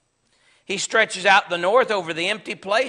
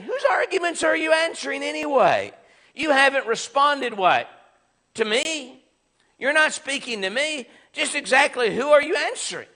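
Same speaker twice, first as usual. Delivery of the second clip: higher-pitched, incredulous questioning; indignant challenge; 6 semitones higher than usual. Acted. Job challenging Bildad for not answering him, indignant. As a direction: demand an answer, pitch pushed up.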